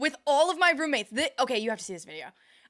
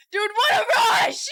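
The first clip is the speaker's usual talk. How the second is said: high, loud, laughing yell; mock-ecstatic shout; excited mimicry; 10 semitones higher, 11 dB louder, slower than usual. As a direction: yell it like a hyped-up gamer, cracking up. Mimics runner's catchphrase over clip.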